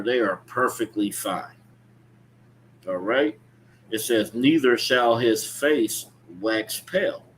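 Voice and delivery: ordinary delivery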